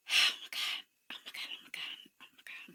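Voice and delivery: breathless whispered disbelief, fading away; stunned whisper. Real, not acted. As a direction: whisper it like you can't believe your eyes. Reactor stunned by scene.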